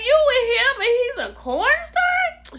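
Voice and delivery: very high-pitched incredulous question; high mock-shocked squeal of a question; mock disbelief. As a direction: squeak it in imitated astonishment. Acts out Tammy's disbelief.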